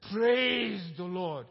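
slow, loud, drawn-out Praise; joyful proclamation. Retold or imitated. Believer answers his partner with a slow, loud praise. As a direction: proclaim it like a joyful shout in church. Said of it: "And the guy said".

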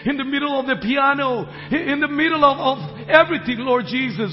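loud, rising church blessing; fervent pulpit blessing; preaching. Real, not acted. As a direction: preach it out over the music.

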